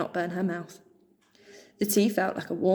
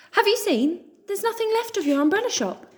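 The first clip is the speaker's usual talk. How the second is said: high, loud gossipy mouse; gossiping quickly; 13 semitones higher, 4 dB louder than usual. Acted. Joe gossiping to Pip, high and eager. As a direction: rattle off the news. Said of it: "speaking quickly like he always did".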